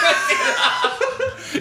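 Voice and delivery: bursting laughter; high laughter. Real, not acted. Laughing at the line.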